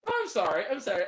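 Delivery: ordinary talk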